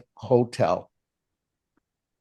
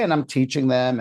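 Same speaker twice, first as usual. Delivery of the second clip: ordinary talk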